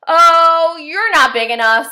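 high, loud, bossy little bug voice; snooty tiny bug, sneering and loud; grouchy ladybug. A narrator's reading. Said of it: "said the grouchy ladybug".

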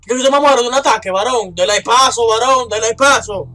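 loud, high, fast panicked yelling; frantic, certain you'll die; panicking gamer. Real, not acted. Pánico, perseguido en el juego. (jugador en pánico, gritando)

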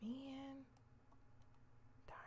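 whisper. Real, not acted. Whispered to herself.

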